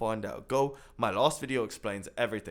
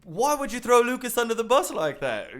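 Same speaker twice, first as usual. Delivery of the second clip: indignant demand; 9 semitones higher, 8 dB louder than usual. Real, not acted. Indignant question to the poster.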